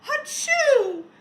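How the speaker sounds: huge high sneeze; a big loud hachoo, full out; giant sneeze. A narrator's reading. Snowman letting out a giant loud sneeze. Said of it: "The snowman sneezed, a giant".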